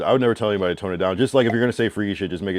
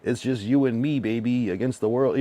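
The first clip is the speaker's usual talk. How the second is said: mock cheesy line; 5 semitones higher than usual. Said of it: "than just saying".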